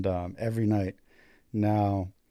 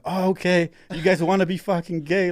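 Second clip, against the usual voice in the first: drill instructor; 8 semitones higher, 5 dB louder than usual. Retold or imitated. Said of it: "now they're like".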